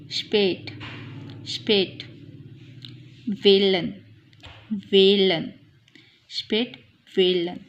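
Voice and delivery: स्पेट and वेलन with a long, held ए; long umlaut vowel. Shown as a demonstration. Teacher giving long sound examples, drawn-out ए in स्पेट, वेलन. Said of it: "long sound में करेगा".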